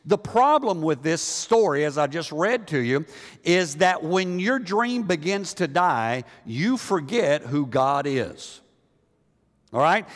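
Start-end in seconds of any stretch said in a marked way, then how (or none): none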